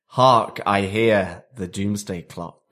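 put-on hammy RP British accent, booming stage announcement; received British accent. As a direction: proclaim it like an RP British stage herald. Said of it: "your received British accent".

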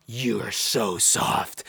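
low, slow, drawn-out scolding; retold self-reproach, stretched out and low; berating himself. Man berating himself in the mirror, low slow scolding. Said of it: "looking at myself".

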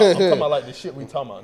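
starts with a laugh, then settles down; laughing, then calm. Real, not acted. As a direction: laugh through it, then say it straight. Repeats the same line, calmer.

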